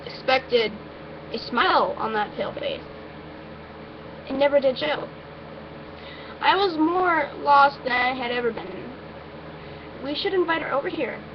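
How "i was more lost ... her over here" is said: ordinary delivery, nothing marked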